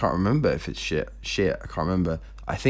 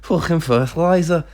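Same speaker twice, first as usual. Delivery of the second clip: mock Scouser saying a swear word; playful Liverpool Scouse accent; 7 semitones higher than usual. Shown as a demonstration. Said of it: "a Scouse accent".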